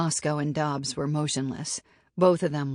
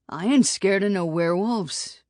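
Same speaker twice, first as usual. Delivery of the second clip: a touch of sulky grumbling; grumbling, a bit louder, stung; 4 semitones higher, 5 dB louder than usual. A narrator's reading. Dobbs grumbling back at Roscoe's teasing, mildly sulky. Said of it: "Dobbs grumbled".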